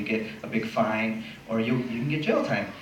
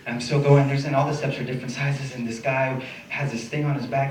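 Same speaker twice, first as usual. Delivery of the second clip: ordinary delivery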